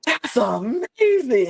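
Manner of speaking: slow mock-amazed coo; slow amazed gasp. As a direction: stretch it out in mock wonder. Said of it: "Laganja's like".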